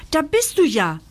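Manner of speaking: höher und lauter gerufen; rufend. A narrator's reading. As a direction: der Tochter zurufen. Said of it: "ruft Lockes Mutter Carla".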